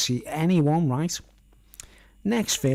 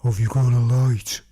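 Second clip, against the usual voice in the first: stranger's voice; 2 semitones lower, 7 dB louder than usual. Retold or imitated. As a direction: imitate the big fella's approach. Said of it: "That was his voice, it was like".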